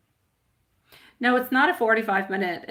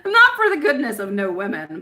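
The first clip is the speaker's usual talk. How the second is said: laughing; 7 dB louder than usual. Real, not acted. Psychic laughing at her own joke to viewers. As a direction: laugh through the punchline.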